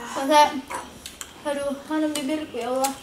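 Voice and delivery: high, pained whine over a burning mouth; lips burning. Real, not acted. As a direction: complain through spicy-hot lips. Lips burning from spicy food. (merintih kepedasan)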